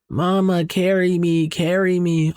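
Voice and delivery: put-on high, thin toddler voice calling from below; eerie baby voice. A buried toddler calls up to her mother, high eerie baby voice. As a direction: sound like a ghostly two-year-old pleading. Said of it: "the two-year-old responding to her".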